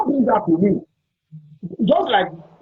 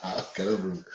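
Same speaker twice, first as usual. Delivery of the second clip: much softer, muttered to himself; quiet aside; 14 semitones lower, 14 dB quieter than usual. Real, not acted. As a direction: drop it under your breath as an aside. Host repeats it to himself.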